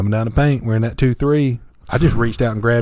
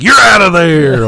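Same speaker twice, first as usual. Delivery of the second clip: angry yell; 7 semitones higher, 7 dB louder, slower than usual. Retold or imitated. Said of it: "He's like".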